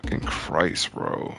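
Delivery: unvoiced, breathy curse under the breath; exasperated whisper. Real, not acted. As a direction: mutter it to yourself, fed up. Frustrated over game rewards.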